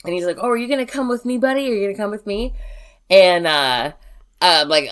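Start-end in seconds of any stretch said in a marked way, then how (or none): 0.4-2.5 high, lilting dog-coaxing voice. Retold or imitated. A creepy visitor luring my dog out the door, coaxing voice. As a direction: imitate a man luring a dog, pitched up. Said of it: "trying to coax the dog out".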